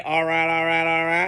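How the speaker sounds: put-on a half-hearted McConaughey Texas accent, barely shifted; Matthew McConaughey accent. Vlogger tossing off a Texas catchphrase while recapping the game, faint McConaughey Texas accent. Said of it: "I was trying to do an accent there".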